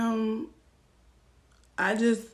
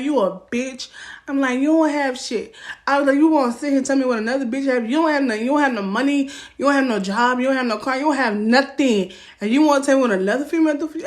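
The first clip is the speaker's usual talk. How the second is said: loud, fast ranting tirade; furious rant at a broke boyfriend; retold angry rant; 3 semitones higher, 3 dB louder, faster than usual. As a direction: tear into him, rapid fire. She rants at boyfriend.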